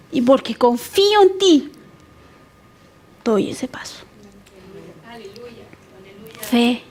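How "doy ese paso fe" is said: whispered, barely there; whispered surrender. Retold or imitated. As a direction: breathe the decision out in a whisper. Quiet surrender to God.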